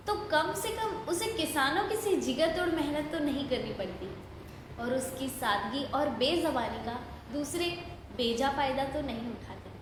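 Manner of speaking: ordinary talk, nothing marked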